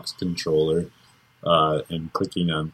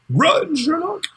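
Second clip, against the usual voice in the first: panicked shout; 3 dB louder than usual. Pretend. Mock adventure-movie panic call.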